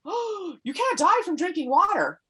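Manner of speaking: high, disbelieving oh; incredulous. Retold or imitated. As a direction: scoff at the very idea. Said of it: "everyone was just".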